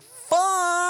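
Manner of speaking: high startled curse; startled by a possum at night; startled yelp. Retold or imitated. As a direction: jump at the sight. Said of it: "You're like".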